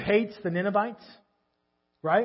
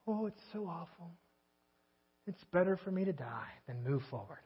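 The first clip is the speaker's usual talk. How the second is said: low, much softer mopey whine; mock whining; 8 semitones lower, 17 dB quieter than usual. Preacher mimicking a moping friend, soft and whiny. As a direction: moan it out like a self-pitying friend. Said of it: "You ever talk to someone like that?".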